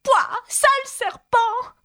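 very high pigeon shriek, voice rising to a squeal; high shrieking bird voice, slowing on the insult; shrill cry. Acted. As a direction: screech it way up as the outraged pigeon. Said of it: "dont la voix monta jusqu'à devenir un cri aigu".

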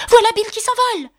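high, excited group cry; exclamation en chœur. A narrator's reading. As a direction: exclaim it high, like a crowd all at once. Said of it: "plusieurs voix qui s'exclamaient en chœur". (exclamation aiguë et stupéfaite)

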